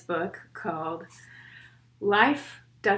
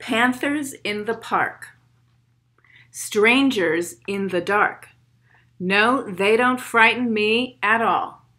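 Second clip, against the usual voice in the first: put-on steady rhythmic poem read for kids; 6 dB louder than usual. Reads poem aloud to children.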